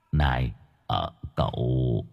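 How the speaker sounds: low, very quiet hesitant call; timid. Acted. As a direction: sheepishly try to start talking. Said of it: "Ông Cường rụt rè". (rụt rè nhỏ nhẹ)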